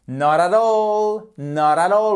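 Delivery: NOT AT ALL linked in one stroke, loud and drawn out; run together as one word. Shown as a demonstration. English teacher models the linking for French learners, run together as one word. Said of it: "je fais la prononciation tout d'un trait".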